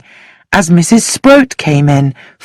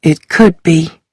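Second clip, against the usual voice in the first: ordinary delivery, nothing marked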